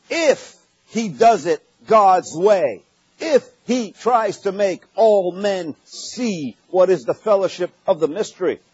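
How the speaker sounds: normal talking voice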